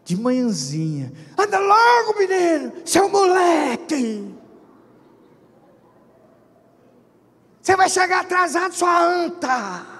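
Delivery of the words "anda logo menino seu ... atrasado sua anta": high, loud, insulting shout; furious parent yelling. Acted. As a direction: scream at the late kid.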